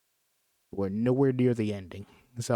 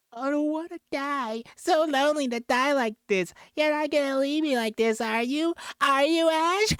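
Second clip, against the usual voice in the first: very high, hammed-up whiny dying plea; squeaky mock dying man. Mocking Scott's dying plea to Ash, squeaky falsetto. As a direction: parody the dying friend in a silly falsetto.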